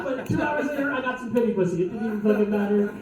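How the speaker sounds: ordinary talk, nothing marked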